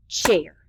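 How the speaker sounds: CHAIR as one loud, low beat; single clapped syllable. Shown as a demonstration. Teacher clapping out syllables with children, CHAIR as one loud beat. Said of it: "Let's clap out our syllables".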